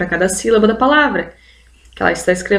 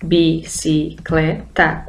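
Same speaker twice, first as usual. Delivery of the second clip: bicicleta stretched into slow syllables, bi-ci-cle-ta; 4 semitones lower, 3 dB quieter, much slower than usual. Shown as a demonstration. Teacher counting syllables for her students, slow syllables. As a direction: tap out each syllable of bicicleta. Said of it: "quatro vezes".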